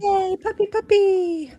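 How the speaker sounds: high, slow drawn-out squeal of joy; excited cheer. Real, not acted. Cohost cheering as the puppy break begins, high and excited.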